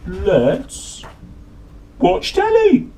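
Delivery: very high, loud squeal on telly; gleeful Slobby Dog. Acted. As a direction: squeal it with delight. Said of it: "said Slobby Dog".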